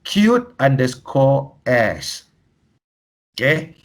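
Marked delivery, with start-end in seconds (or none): 0.0-2.3 slowly, each part spelled out; slow reading out. Shown as a demonstration. Reading the worst email address to trainees, slowly. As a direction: read the address out deliberately. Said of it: "an email address that reads".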